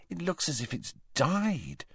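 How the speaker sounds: soft, high, queasy disgust; nauseated. A narrator's reading. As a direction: say it softly, feeling sick. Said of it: "with a nauseated expression".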